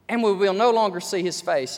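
ordinary delivery

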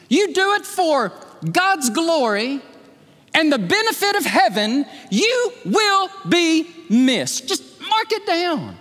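high, slow, emphatic sermon voice; preaching. Acted. Pastor urges the congregation to serve God, preaching high and slow.

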